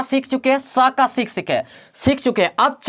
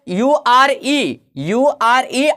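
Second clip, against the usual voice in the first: slow spelling; much slower than usual. Shown as a demonstration. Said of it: "जो आता है यूआरए".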